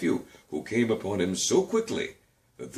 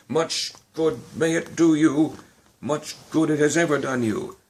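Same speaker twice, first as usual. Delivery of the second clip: loud, high, sneering jab; mock him hard; 7 semitones higher, 6 dB louder than usual. Scrooge sneers at his nephew, loud and scornful.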